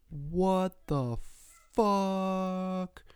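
high, drawn-out mock astonishment; long high wail of disbelief; stunned disbelief. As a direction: stretch each word out in high-pitched amazement. Retold disbelief at getting no citation.

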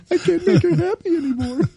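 very high, laughing whine; laughing mock despair. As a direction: wail it helplessly through laughter. Joking as robot wife's helpless husband.

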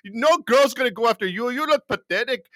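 high, loud, teasing imitation Indian accent; jeering mock Indian accent. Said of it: "they had these Indian guys".

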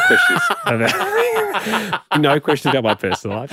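mock-formal rule, breaking into laughs; teasing Tom Cruise with the publicist's own rule, laughing; laughing through it. Jokingly repeats publicist's rule.

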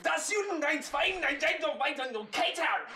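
high, hammed-up fake German; mock German. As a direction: bark it like a cartoon German. Mock German riff on cater.

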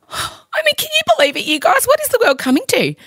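high, horrified outrage; mock shock. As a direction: gasp in hammy disbelief.

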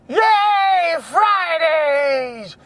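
high excited cheer; whooping Friday cheer. Real, not acted. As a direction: cheer it out, pitch way up.

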